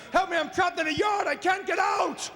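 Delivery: high-pitched frantic dog voice; barking dog. Acted. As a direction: yelp for rescue, turning words into barks. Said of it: "What is your dog trying to communicate".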